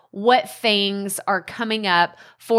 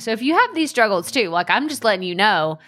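ordinary talk

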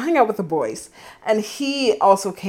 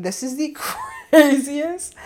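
higher, laughing through the words; laughing disbelief; 7 semitones higher than usual. Retold or imitated. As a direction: crack up mid-quote at the text. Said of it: "And I was just like".

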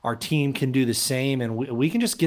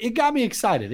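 excited; 9 semitones higher than usual. Real, not acted. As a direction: voice jumps up with excitement. Recalls seeing Tua walk in.

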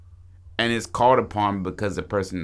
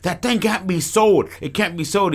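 high, loud quoted outburst; imitated alarmed objection; acted urgent protest; 9 semitones higher, 4 dB louder than usual. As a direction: blurt it out high and insistent. Acts out creature's outburst.

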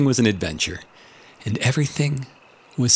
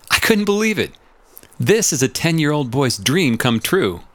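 much higher pitch, bursting with amazement; astonished and thrilled; excited disbelief; 5 semitones higher than usual. Real, not acted. Boy's dream come true.

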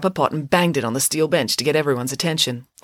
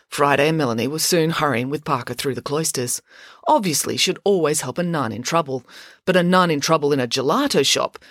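plain reading voice

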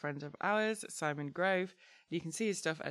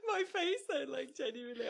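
laughing; 8 semitones higher than usual. Real, not acted. Still laughing.

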